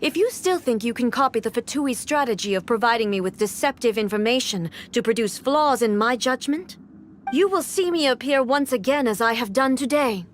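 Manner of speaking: ordinary talk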